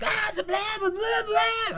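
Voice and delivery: high mock yelling gibberish; imitating yelling lady. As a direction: squeal nonsense like the crazy lady in the alley. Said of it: "I hear this lady yelling behind me".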